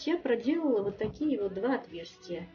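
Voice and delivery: normal talking voice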